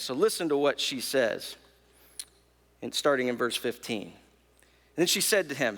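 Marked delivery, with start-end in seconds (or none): none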